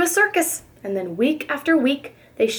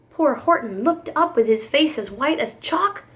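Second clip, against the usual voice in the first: plain reading voice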